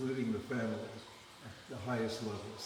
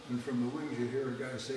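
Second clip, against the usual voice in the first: ordinary talk, nothing marked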